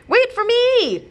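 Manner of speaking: high, loud, stretched call; child's yell. Acted. Child yells after the zombie grandparents, high loud call. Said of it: "you yell".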